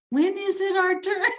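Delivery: high, whiny plea of waiting muses; muses' plea. Retold or imitated. Author voicing her muses pleading for their turn. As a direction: plead like neglected characters. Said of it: "just like".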